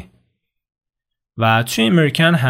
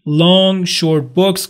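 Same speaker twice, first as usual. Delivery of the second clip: put-on "Old new houses" very slow and higher; slow vocabulary reading; 5 semitones higher, 4 dB louder, much slower than usual. Shown as a demonstration. Teacher reading textbook vocabulary aloud. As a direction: read each word slowly. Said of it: "reading vocabulary pairs".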